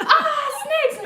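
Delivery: high, loud hammed-up scream; mock panic. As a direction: shriek in comic terror at the sight. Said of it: "We're like, we're like".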